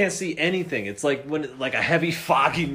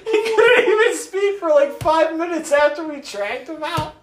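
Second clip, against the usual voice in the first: laughing; 13 semitones higher, 4 dB louder than usual. Real, not acted. Teller laughing.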